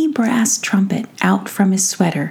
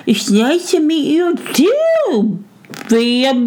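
high, slow garbled mouse voice; mouth too full; 7 semitones higher than usual. A narrator's reading. Mouse returns a greeting with peas in his mouth, mouth full. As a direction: mumble it through stuffed cheeks, barely intelligible. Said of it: "with his mouth really too full to speak".

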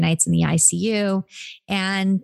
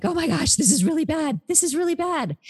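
imitating a panicked husband, pitched much higher; mock alarm, high and rising in pitch; 10 semitones higher than usual. Surgeon husband panicking over a bleeding child, high mock panic. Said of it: "And he's like".

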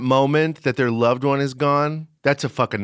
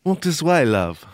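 put-on British accent; 3 semitones higher than usual. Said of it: "your exact accent".

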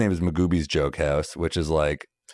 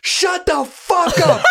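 much higher, louder, slow laughing mock scream; screaming owner; 6 dB louder, much slower than usual. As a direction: explode at the rowdy crowd. Owner snaps at crowd.

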